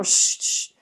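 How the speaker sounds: whispered "chut, chut"; hushing whisper. Retold or imitated. Mother hushing the laughing cousins, whispered. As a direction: hush them under your breath.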